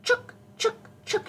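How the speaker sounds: high, sharp squirrel chucks; squirrel chatter. A narrator's reading. Teacher showing children what mama squirrel says, high squirrel chatter. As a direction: squeak it out in quick separate bursts. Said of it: "she'd say".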